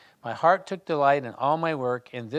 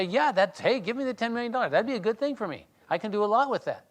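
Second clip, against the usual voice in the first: higher, quick, grabbing at the money; eager mock reply; 7 semitones higher, faster than usual. Teacher imitates a stranger offered ten million dollars, mock eager. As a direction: play the giddy winner, a bit hammed-up.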